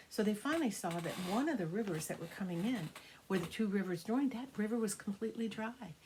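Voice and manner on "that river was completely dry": plain reading voice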